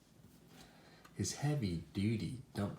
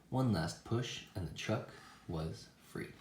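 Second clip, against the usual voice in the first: ordinary delivery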